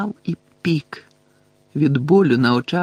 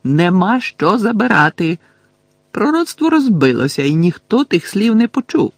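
loud, higher, insistent cry; Harry shouting; 6 semitones higher, 5 dB louder than usual. A narrator's reading. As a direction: shout it to drive the point home. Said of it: "крикнув він". (гучний піднесений крик)

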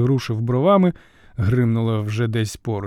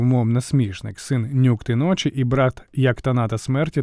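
plain reading voice

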